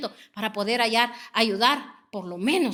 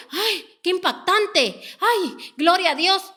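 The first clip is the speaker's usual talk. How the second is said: higher, slow, hammed-up gushing; mock gushing praise; 7 semitones higher, 4 dB louder, slower than usual. Preacher mocking believers who are moved only for a moment, hammed-up gush. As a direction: parody a fleeting believer's excitement. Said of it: "En el momento".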